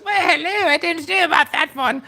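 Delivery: very high-pitched silly Cockney. Shown as a demonstration. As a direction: squeal it in a comic Cockney accent. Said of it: "my Cockney voice".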